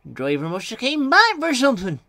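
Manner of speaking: high character voice, normal volume; high-pitched little engine; little engine thinking. A narrator's reading. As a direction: wonder to yourself in a high engine voice.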